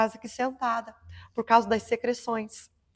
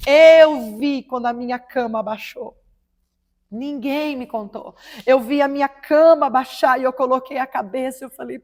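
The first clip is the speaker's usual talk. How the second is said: ordinary talk, nothing marked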